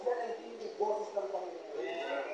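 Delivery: softly awed words on the voice of the Lord; hushed reverence. Real, not acted. Reverent hush on God's voice.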